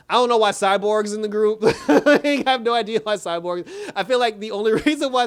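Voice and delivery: high, loud talk through laughter; really laughing, voice jumping up; laughing disbelief. Real, not acted. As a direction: crack up while saying it. Amused disbelief at the episode.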